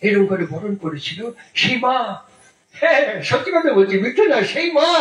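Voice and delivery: usual speaking voice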